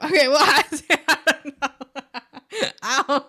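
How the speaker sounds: words dissolving into laughter; laughing. Real, not acted. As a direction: caught laughing at own mistake. Laughing at her mistake.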